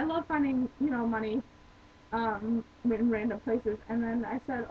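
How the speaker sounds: normal talking voice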